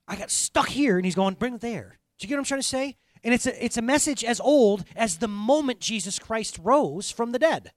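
loud, high, fervent preaching; rising sermon fervor; preaching at full force. Acted. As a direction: proclaim it to the back row. Preaching climax.

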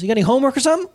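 high, rising incredulous query; mock baffled questioning. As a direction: act out puzzled prying. Retold probing questions to upset friend.

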